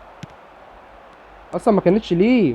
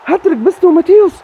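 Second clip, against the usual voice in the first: much higher, louder cry of delight; excited shout; 11 semitones higher, 4 dB louder than usual. Real, not acted. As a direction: celebrate like a goal just went in. Celebrating the hat-trick. (صياح فرح عالٍ)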